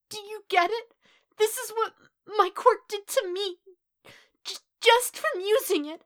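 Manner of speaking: high, loud anguished pleading; tearful, building to an anguished cry; distraught. A narrator's reading. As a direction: stammer it out desperately, high-pitched. Distraught boy revealing his scars.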